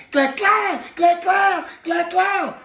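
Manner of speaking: high, loud twinkle noise; sparkle sound. Pretend. Host imitating his beard's shine in the sun, bright sparkle noise. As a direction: make the sound of the beard gleaming.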